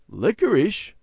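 high-pitched echo in surprise; surprised. A narrator's reading. Mother hearing Randy ate licorice, surprised. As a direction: repeat it in astonishment. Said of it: "Mother said in surprise".

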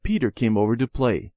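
ordinary delivery

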